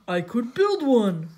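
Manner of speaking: slow, loud suggestion; Patrick. A narrator's reading. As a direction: offer it loudly, drawing the words out. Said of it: "said Patrick".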